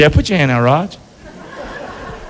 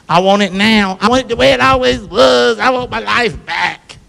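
a hammed-up complaint, rising to a shout at the end; mock whiny demand. Preacher mimicking a believer who wants life back, mock whiny shout. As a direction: act out the impatient believer's demand.

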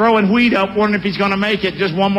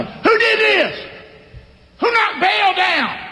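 high, slow, shouted demand from an angry mob; angry townsmen; 10 semitones higher, slower than usual. Acted. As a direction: bellow it like an outraged crowd.

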